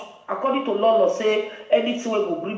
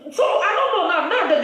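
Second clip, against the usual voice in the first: a laugh breaks through the words, pitched higher; amused, laughing aside; 8 semitones higher, 3 dB louder than usual. Real, not acted. Laughing aside mid-rant.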